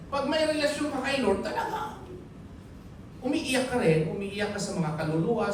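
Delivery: ordinary delivery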